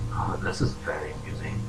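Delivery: hushed, amused villain whisper; amused Mind Near. Acted. Game master as the Mind Near chuckling at a player, amused whisper. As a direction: whisper it low, quietly amused. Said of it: "The Mind Near chuckles slightly".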